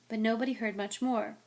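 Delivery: usual speaking voice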